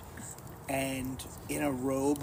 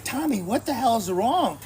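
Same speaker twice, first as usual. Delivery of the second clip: higher, louder alarmed call; retold alarm, raised voice; alarmed shout; 9 semitones higher, 7 dB louder than usual. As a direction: call out to the crying brother. Alarmed at crying brother.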